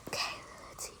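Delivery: soft unvoiced whisper; hushed whisper. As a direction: whisper to yourself. Sneaking inside.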